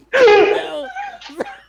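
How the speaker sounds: helpless high laughter at a grim story; shocked high laugh. Real, not acted. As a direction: burst of high shrieking laughter. Shocked at tiger death.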